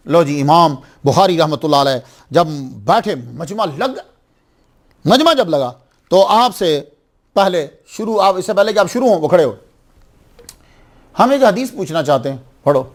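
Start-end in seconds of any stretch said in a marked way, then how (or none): none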